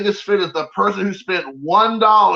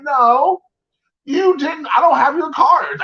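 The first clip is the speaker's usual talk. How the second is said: higher-pitched, exasperated retelling of a protest; 10 semitones higher, slower than usual. Retold or imitated. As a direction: replay the argument, pitch jumping up in disbelief. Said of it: "And I'm like".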